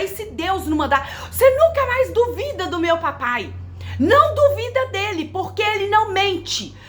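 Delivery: high-pitched angry telling-off, reliving it; retold furious rebuke of a doubting husband; furious scolding. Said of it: "saí no corredor falando com ele brava".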